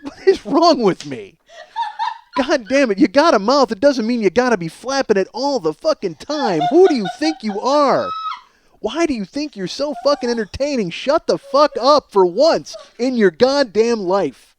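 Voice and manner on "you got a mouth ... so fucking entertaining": ordinary talk, nothing marked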